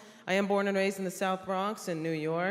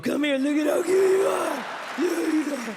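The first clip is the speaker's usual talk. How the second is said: higher, louder doting coo; cooing boyfriend; 7 semitones higher, 5 dB louder than usual. Pretend. Boyfriend cooing over his small partner, higher and louder. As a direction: coo over them like a cute baby.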